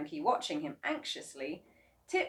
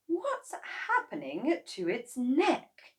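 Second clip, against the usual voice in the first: alarmed; 8 semitones higher, 4 dB louder, much slower than usual. A narrator's reading. Mrs Kranky alarmed.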